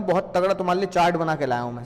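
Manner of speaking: usual speaking voice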